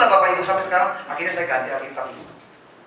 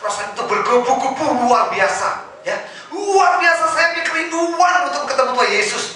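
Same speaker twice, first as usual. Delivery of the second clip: fervent longing; 10 semitones higher, 5 dB louder than usual. Retold or imitated. Burning longing to meet Jesus.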